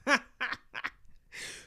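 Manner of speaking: breathy burst of laughter. Real, not acted. Speaker amused at own congested breathing demo, laughing.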